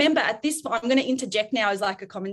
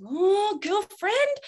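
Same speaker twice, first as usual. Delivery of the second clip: sassy mum; 10 semitones higher, much slower than usual. Retold or imitated. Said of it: "And I was like".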